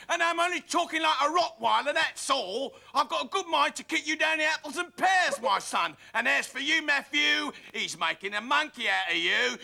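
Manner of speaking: a rough Cockney geezer telling people off; Rottweiler London. Acted. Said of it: "a touch of lovely London, that Rottweiler volume".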